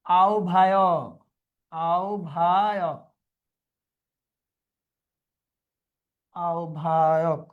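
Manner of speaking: teacher repeating আহ্বায়ক for dictation; slow repeated pronunciation. Shown as a demonstration. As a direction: drill আহ্বায়ক slowly. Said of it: "teacher drilling word".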